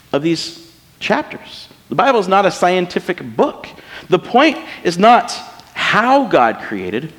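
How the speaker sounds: ordinary talk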